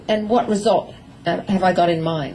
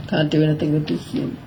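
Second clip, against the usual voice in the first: lazy horse act; 6 semitones lower than usual. Retold or imitated. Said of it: "It's like".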